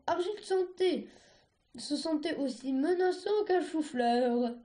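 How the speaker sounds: ordinary delivery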